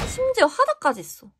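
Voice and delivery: high, delighted exclaim; delighted surprise. Real, not acted. Fan finding a bonus card, surprised.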